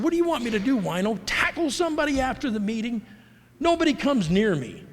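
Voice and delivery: frustrated outburst. Retold or imitated. Newcomer snapping at his old sponsor in the parking lot, exasperated. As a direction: throw it back at him in frustration. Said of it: "one day in frustration".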